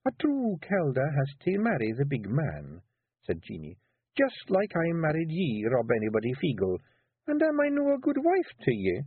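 a wife laying a trap sweetly; Kelda Jeanie. Acted. Jeanie voiced.

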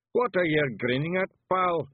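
loud, high Scottish challenge; belligerent demand. Acted. Daft Woolly challenging a garden gnome, belligerent. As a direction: pick a fight in high Scots. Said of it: "he demanded".

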